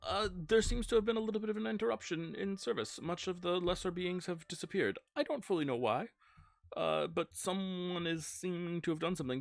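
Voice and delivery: high-pitched, hesitant official report; airy deity stalling with ums and uhs; hedging god character. Acted. GM plays Samhain reporting back.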